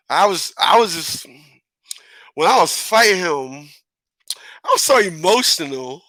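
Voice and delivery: hammed-up emotional halting delivery; acted overemotional. Reenacting the fight with his brother, mock overwhelmed. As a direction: stumble through it as if overwhelmed. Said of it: "let me act on it the right way".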